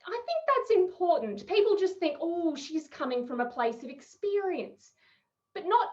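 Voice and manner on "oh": ordinary talk, nothing marked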